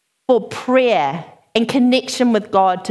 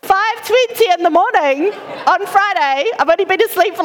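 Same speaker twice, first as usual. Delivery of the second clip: aghast; 9 semitones higher than usual. Retold or imitated. Retells horror of early wake-up.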